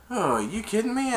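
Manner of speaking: high, swooping disbelief; mock amazement. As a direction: imitate someone amazed, pitch leaping up. Retold reaction, repeated.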